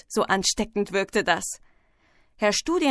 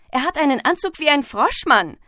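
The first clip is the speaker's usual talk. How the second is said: higher-pitched schoolboy call; lively, raised pupil's voice; 6 semitones higher, 3 dB louder than usual. A narrator's reading. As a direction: call it out across the classroom. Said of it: "rief ein dritter".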